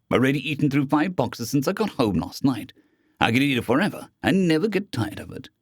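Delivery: plain reading voice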